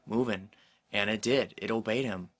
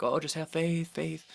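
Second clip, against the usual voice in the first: higher, sing-song parody of glib advice; lightly mocking, higher repeat of "faith"; mocking platitude; 6 semitones higher than usual. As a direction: mimic people brushing you off with a cliché. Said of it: "takes me off".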